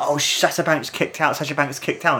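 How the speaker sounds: mock a TV commentator's excited call; Michael Cole impression. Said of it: "Michael Cole going like".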